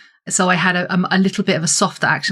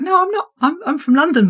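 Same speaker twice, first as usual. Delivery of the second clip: retold protest; 10 semitones higher than usual. Retold reply insisting London.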